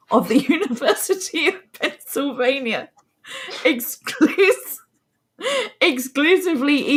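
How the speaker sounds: reading through laughter; giggling over the words; laughing. Real, not acted. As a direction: try to read on while laughing. Cracks up reading the researcher's name.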